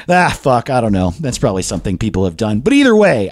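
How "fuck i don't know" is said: ordinary talk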